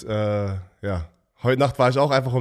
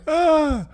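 high laughter; 3 dB louder than usual. Real, not acted. Bursts out laughing at the teasing.

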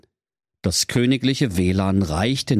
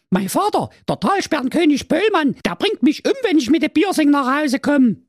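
very high, loud mermaid voice; frightened mermaid; 3 dB louder than usual. Acted. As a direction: mermaid fearing her father's wrath.

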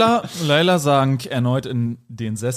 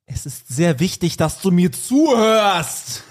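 slow, forceful demand, rising high; emphatic shouting; 4 semitones higher, slower than usual. A narrator's reading. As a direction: build from firm to a drawn-out shout. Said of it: "aber doch rumschreiend und, äh, laut".

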